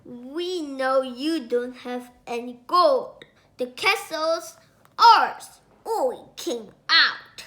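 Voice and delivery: loud, mocking threats; laughing bullies shouting; gloating robbers. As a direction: jeer at the trapped king.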